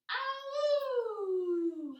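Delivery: high, loud, long howl; dog howl. Pretend. Teacher leading kids in upward dog, playful howl.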